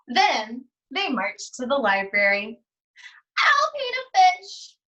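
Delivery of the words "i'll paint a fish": put-on squeaky high little-kid voice; a classmate calling out in a high, eager child voice. A narrator's reading. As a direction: pitch way up like an eager young student. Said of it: "said one person".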